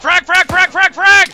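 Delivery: urgent yelled warning, high and loud; grenade warning shout. Real, not acted. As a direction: bellow it to warn the squad. Grenade landing, warning squad.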